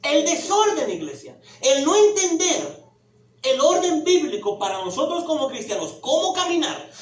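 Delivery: fiery pulpit preaching; loud soapbox ranting. Acted. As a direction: preach it hard to the congregation. Preaching at full force.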